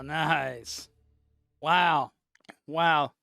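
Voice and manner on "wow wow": ordinary delivery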